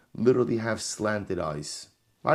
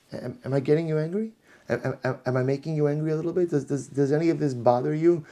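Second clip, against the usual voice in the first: higher, teasing needling voice; mock needling; 6 semitones higher, faster than usual. Mock baiting questions to Hillel.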